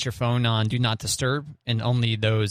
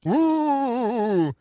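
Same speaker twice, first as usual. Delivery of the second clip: ghost noise; 12 semitones higher than usual. Shown as a demonstration. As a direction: moan like a cartoon ghost. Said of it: "I'm gonna go".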